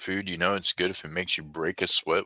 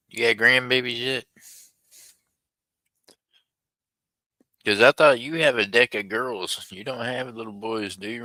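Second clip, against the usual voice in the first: excited; 5 semitones higher, 4 dB louder than usual. Real, not acted. Excited teasing questions.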